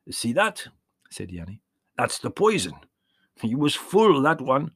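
put-on warm old peasant shepherd, proud of his trick; old Yanni voice. A narrator's reading. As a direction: voice the old shepherd showing the boy. Old shepherd explains poison.

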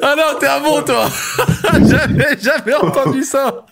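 laughing. Real, not acted. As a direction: savour it while still laughing. Still laughing at the joke.

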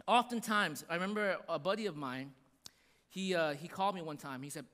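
ordinary talk

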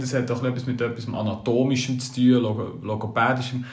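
ordinary talk, nothing marked

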